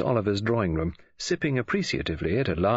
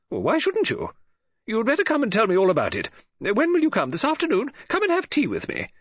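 bright, higher-pitched woman's voice; Mrs Oliver; 13 semitones higher, 4 dB louder than usual. A narrator's reading. Novelist greeting Poirot on the phone, bright high voice. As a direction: play the chatty lady novelist, lifted and eager.

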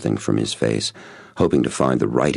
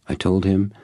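plain reading voice

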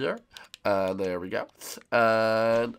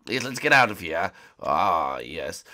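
usual speaking voice

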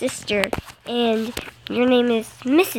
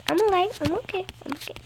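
usual speaking voice

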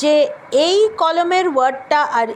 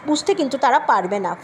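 usual speaking voice

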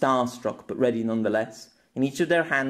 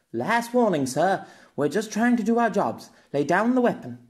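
higher, firm police voice; stern young officer, higher; officer's warning; 8 semitones higher than usual. Acted. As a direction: warn him off, pitched up. Officer warns Pierre.

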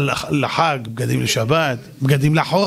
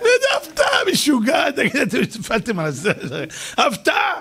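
mock excited shout; 4 semitones higher than usual. Comedian mocking the dog surprise party with high shouts.

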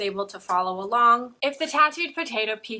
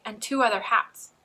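ordinary delivery

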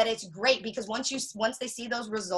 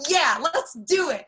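higher, louder and slower, bursting with excitement; excited; 6 semitones higher, 5 dB louder, slower than usual. Retold or imitated. Showing viewers her excited reply to a client, higher and louder. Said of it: "Can you guys imagine the excitement?".

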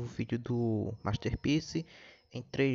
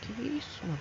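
shocked; 11 semitones higher, 5 dB quieter than usual. Real, not acted. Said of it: "shocked reaction".

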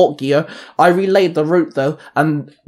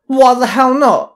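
high, loud, slow challenge; grinning defiance; 9 semitones higher, 5 dB louder than usual. A narrator's reading. Hattie grinning to the narrator about the weapons. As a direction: grin and push back. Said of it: "Hattie grinned".

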